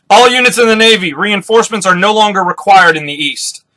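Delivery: acted commanding announcement; military broadcast. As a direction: announce to all units. Officer broadcasts orders to all units.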